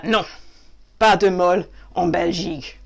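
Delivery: hammed-up French-speaking Belgian; Belgian accent. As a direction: play a Belgian answering in French. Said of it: "Stop the Belgian thing".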